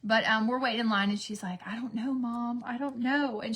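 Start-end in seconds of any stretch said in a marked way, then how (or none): none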